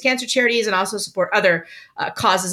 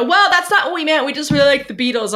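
mock parents; 5 semitones higher than usual. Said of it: "they go".

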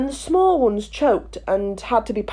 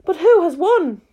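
higher and louder, eager; eager question; 9 semitones higher, 4 dB louder than usual. A narrator's reading. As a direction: blurt it out breathlessly. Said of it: "panting and asking".

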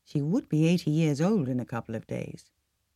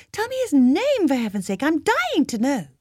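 much higher and louder, eager pleading; pressing eagerly; 11 semitones higher, 6 dB louder than usual. A narrator's reading. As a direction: beg to know, impatient. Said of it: "Paula pressed".

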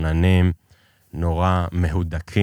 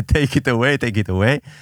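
put-on high-pitched English with an Israeli accent; israeli hebrew; 6 semitones higher, much slower than usual. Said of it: "מלווה במבטא ישראלי".

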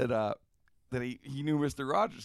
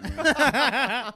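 mock yell; 13 semitones higher, 12 dB louder than usual. [name] yelling at Robert.

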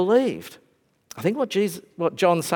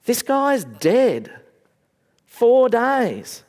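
exasperated objection; 7 semitones higher, 3 dB louder, slower than usual. Retold or imitated. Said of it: "but also saying".

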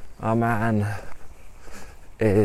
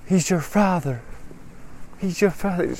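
dramatic revelation; 9 semitones higher than usual. Acted. Shelley reveals the father.

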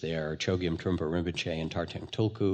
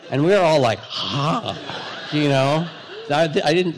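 laughing; 7 semitones higher, 8 dB louder than usual. Real, not acted. Laughing while retelling the smack.

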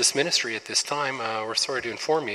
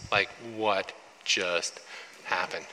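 lower, softer, slow stunned voice; awed hush; 6 semitones lower, 9 dB quieter, slower than usual. Retold or imitated. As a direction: hush it in disbelief. Disciples stunned at the miracle.

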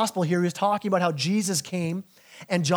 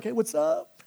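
lightly voiced groom, higher pitch; groom's casual greeting; 7 semitones higher, 4 dB quieter, slower than usual. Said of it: "the groom's like".

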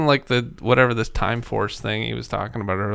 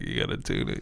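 low whispered aside; breathy hushed instruction; whispered quote; 12 dB quieter than usual. Retold or imitated.

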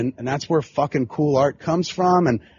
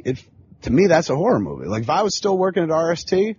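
ordinary talk, nothing marked

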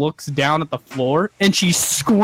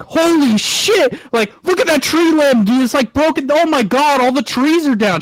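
loud, high mock panic; imitated freak-out; 11 semitones higher, 6 dB louder than usual. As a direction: act out a passenger alarmed at fallen trees. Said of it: "She's, like, freaking out".

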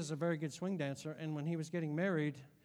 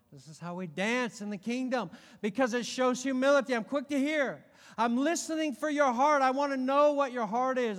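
raised, loud preacher's voice; impassioned preaching; 7 semitones higher, 11 dB louder than usual. Real, not acted. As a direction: press it home to the congregation, pitched up. Preaches at full force.

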